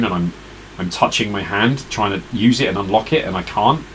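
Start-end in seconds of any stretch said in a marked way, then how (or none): none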